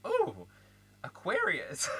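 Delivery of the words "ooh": usual speaking voice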